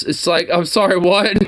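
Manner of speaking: high, incredulous I'm sorry, what; baffled. Real, not acted. Streamer failing to understand the man's accent, baffled. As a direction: sound totally lost at his words. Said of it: "really am having a hard time to understand".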